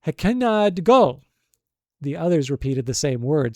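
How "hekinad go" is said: high, loud call, drawn out and clearly articulated; shrill distinct cry. A narrator's reading. Tiny islander exclaiming in admiration at the giant, shrill cry. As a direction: cry it out shrill but distinct, each syllable slow. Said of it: "cried out in a shrill but distinct voice".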